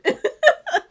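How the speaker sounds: squealing laugh; high laugh. Real, not acted. Laughing at own remark.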